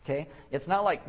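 usual speaking voice

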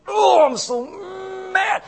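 high, drawn-out mock-furious shout; hammed-up rage, dragging out the words; shaking with anger. Preacher acting out the word angry, shaking mock rage. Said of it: "angry here is like shaking".

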